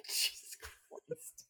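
normal talking voice

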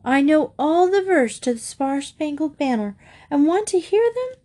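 put-on high-pitched little girl, eager to perform; excited kid showing off; eager child. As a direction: pipe up like a keen child, rising high at the end. Child pleads with her mother to listen.

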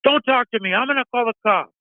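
high, mock huffy voice of the woman in line; imitating the angry lady. Caller mimicking a woman threatening to call the cops, high and huffy. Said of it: "She's all".

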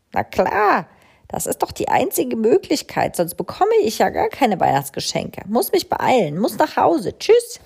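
high, rushed excited crow; hurried crow. A narrator's reading. Crow rushing home to Mama Mu, excited. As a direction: dash off in a hurry.

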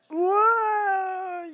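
high, loud gagging bleh; disgusted bleh. Real, not acted. Disgusted by gross scene.

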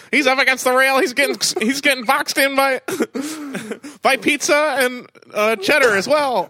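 high, loud race caller voice; race announcer. Pretend. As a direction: call the race like an old-time announcer. Continues mock race commentary.

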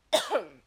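one sharp loud cough; a real, sudden cough; coughing fit. Real, not acted. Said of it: "I'm coughing here".

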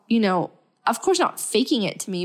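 usual speaking voice

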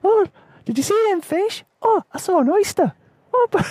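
put-on very high squeaky kid voice, excited; excited little kid. Rescued kid chattering excitedly about fish and oysters. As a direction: chirp it out like a thrilled seven-year-old. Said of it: "this kid's like".

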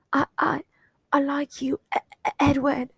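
nervous high stutter; stammering confession. Acted. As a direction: stumble over the words shyly.

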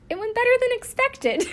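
squeaky-high, laughing through the words; cracking up. Real, not acted. Podcaster quoting the father-in-law's interview answer, helpless with laughter. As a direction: fight real laughter while delivering the punchline.

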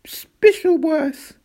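high, loud stammering sobs; stuttering through tears, very high; sobbed. A narrator's reading. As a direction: choke out the name in pieces. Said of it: "sobbed Roderick".

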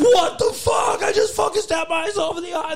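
high panicked shriek, voice cracking; freaking out after stabbing her own eye. Retold or imitated. Christine shrieks in panic at the guys after stabbing her eye.

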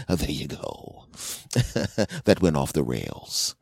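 slow whisper; whispered aside. Real, not acted. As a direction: whisper it to the audience. Aside after bit.

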